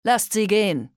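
loud commanding call; shouted order. Acted. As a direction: bark the order to his men. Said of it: "Den Männern rief er zu".

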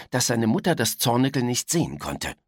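ordinary delivery, nothing marked

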